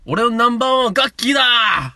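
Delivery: loud, high shout of love; passionate shout. Shown as a demonstration. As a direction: yell it with all your heart. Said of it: "じゃあお前がやってみれ".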